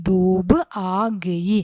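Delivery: drawn out, word by word; slow model reading. Shown as a demonstration. Teacher rereading the title slowly for the pupils. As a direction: read the line slowly for copying. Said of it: "teacher rereads the poem title".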